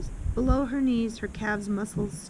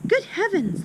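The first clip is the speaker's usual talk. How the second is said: much higher, a gasp of surprise; shocked little girl; 11 semitones higher, 4 dB louder than usual. Acted. Small girl reacting to the hammer story, much higher and shocked. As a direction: pitch way up in shock.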